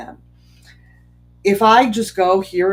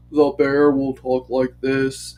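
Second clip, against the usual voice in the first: lower, a lightly voiced bear; a hint of a big slow bear, pitched down; gentle storytime bear, a touch lower; 5 semitones lower, 4 dB louder, slower than usual. Shown as a demonstration. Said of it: "change the intonation if I'm talking about certain characters".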